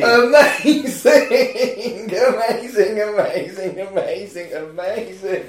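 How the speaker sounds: laughing, stretched cry settling into a chant; real delighted amazement. Real, not acted. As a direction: burst out high and laughing, then repeat it. Delight at Bernie reveal.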